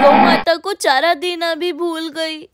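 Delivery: usual speaking voice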